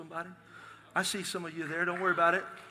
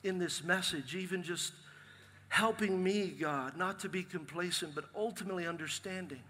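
softer, earnest praying voice; closing prayer; 2 semitones lower, 3 dB quieter than usual. Acted. Pastor prays over the congregation, softly earnest. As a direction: pray it quietly over the room.

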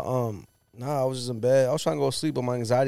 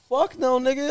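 1 mock outraged; 12 semitones higher than usual. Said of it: "I was like".

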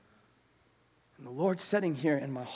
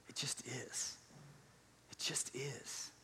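soft unvoiced whisper; 16 dB quieter, slower than usual. Real, not acted. Preacher hushing to the church, whispered. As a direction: drop to a whisper, softer still. Hushed aside in sermon.

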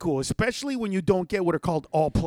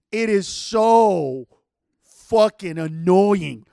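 very slow, louder, each word drawn out; loud, dragged-out annoyance; exasperated emphasis; 7 dB louder, much slower than usual. Real, not acted. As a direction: stretch every word in exasperation. Venting about the game.

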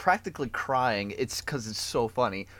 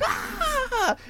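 mock scream. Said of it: "then she's like".

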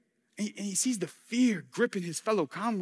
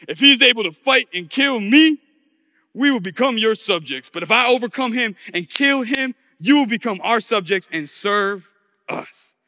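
loud, declaimed terms of combat; Goliath's challenge; 2 semitones higher, 7 dB louder than usual. Acted. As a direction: lay down the terms loudly to the enemy.

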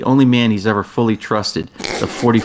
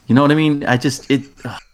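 ordinary delivery

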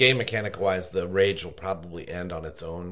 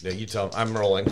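usual speaking voice

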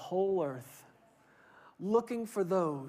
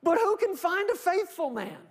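preacher reading scripture, louder at the end; 5 semitones higher, 5 dB louder than usual. Real, not acted. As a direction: read out with rising force. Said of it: "But listen to what he says".

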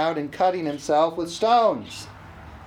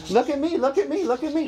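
lightly voiced devil, pitched up; attention-seeking devil; 5 semitones higher, faster than usual. Devil distracts people, mock attention-seeking. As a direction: pester for attention in a higher voice. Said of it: "That's the devil".

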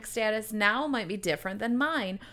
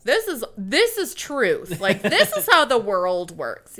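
preachy know-it-all; 8 dB louder, slower than usual. Retold or imitated. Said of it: "be like".

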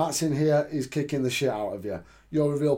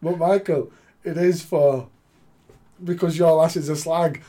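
mock indignant; 5 semitones higher, 7 dB louder than usual. Mock-offended joke reply to co-host.

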